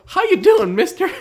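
high, loud cartoonish kid voice; silly kid voice. Answering his cohost's bit, loud and squeaky like a kid. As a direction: shout a goofy greeting in a squeaky kid voice.

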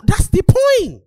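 high, loud insistence; exasperated shout. Real, not acted. Arguing back at a co-host, exasperated shout. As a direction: burst out as if nobody gets it.

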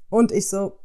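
ordinary talk

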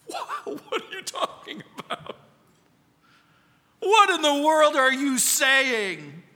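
high, laughing disbelief that turns into a loud outcry; incredulous protest. Retold or imitated. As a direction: react as if the request were absurd, laughing then crying out. Listener's incredulous reply to God.

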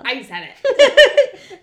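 slow, loud words through laughter; real laughing repeat. Real, not acted. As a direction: say it while cracking up.

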